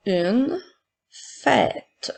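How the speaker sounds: word by word with pauses; careful dictation for pupils to write. Shown as a demonstration. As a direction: dictate slowly, each word set apart. Teacher dictating words to pupils.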